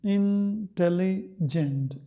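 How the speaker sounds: Intelligent drawn out slowly, syllable by syllable; slow teacher's demo of intelligent. Shown as a demonstration. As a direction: model intelligent slowly for students to count syllables. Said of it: "polysyllabic हम कहेंगे intelligent में".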